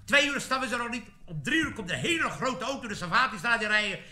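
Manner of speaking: usual speaking voice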